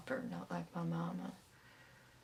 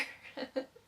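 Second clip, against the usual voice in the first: brief breathy chuckle; short laugh. Acted. Imagining the aunt's face, short chuckle. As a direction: let out a small trailing laugh.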